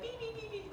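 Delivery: high, soft mechanical beep beep; little imitated beeps; mock beeping. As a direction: chirp out the beeps like a tiny alarm. Imitating bird sensor beeps.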